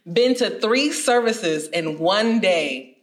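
ordinary talk

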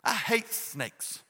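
slow, drawn-out "I hate snakes"; mock disgust. Real, not acted. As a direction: stretch it out like a shudder. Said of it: "Snake scares the pastor".

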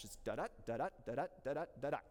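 da-da chanted as short weak beat then strong beat, five times over; rhythmic da-DA chant of the meter; iambic da-da rhythm. Shown as a demonstration. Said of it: "That's how it works".

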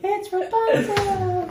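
Rapunzel in a Spanish accent, slow and laughing. Real, not acted. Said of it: "they are laughing at my accent".

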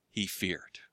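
dropped to a near-silent, low hush. Acted. Preacher telling the congregation Elijah feared, hushed low. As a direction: let it fall away almost to nothing.

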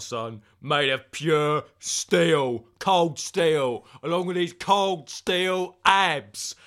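slow, loud hard-man bragging; mock tough guy stretching out each boast; hammed-up bad cop. Threatening the unpaid guest, slow loud bad-cop voice.

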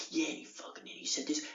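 hushed mocking whisper; whispered taunt. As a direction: whisper it like a sneer. Neighbour mocking him, retold.

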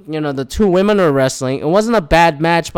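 usual speaking voice

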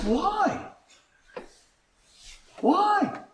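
high, soft, incredulous why; questioning challenge. Retold or imitated. Preacher questioning an acquaintance's outcry, high soft challenge. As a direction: ask it high and soft, leaving it hanging.

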